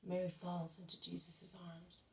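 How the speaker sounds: ordinary delivery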